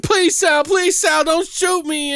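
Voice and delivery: high, desperate begging; mock pleading victim. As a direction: beg for your life, voice up high. Begging mobster victim in retold scene.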